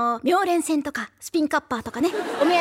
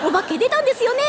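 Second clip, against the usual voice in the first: a bit higher, cutesy and giggling; mock cute voice; 6 semitones higher than usual. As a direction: parody a too-sweet storyteller. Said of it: "みたいになるよね。".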